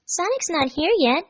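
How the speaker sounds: put-on high girlish remark; Amy observing. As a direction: point it out with a teasing lift. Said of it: "Amy observed".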